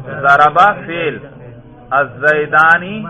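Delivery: normal talking voice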